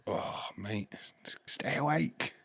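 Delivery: unvoiced whisper, slow at the end. Teasing a sleepy friend to stay awake, whisper. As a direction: whisper to keep a mate awake.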